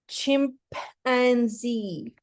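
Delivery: CHIMPANZEE said slowly, in separate chunks; broken into syllables. Shown as a demonstration. Pupil sounding out for the teacher, syllable by syllable. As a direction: break CHIMPANZEE into chim, pan, zee with gaps. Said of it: "And all together?".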